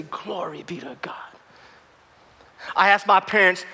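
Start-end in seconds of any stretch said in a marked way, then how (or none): 0.0-1.1 breathy hushed glory be to God; whispered praise. Retold or imitated. Dad pausing in the hallway, whispering praise. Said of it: "lift his hands and say".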